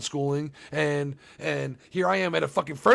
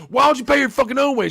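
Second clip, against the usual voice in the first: furious mock yell, pitched way up; angry shout; 9 semitones higher, 9 dB louder than usual. Husband yelling at his wife in role play, loud. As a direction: yell it much higher and louder at the wife.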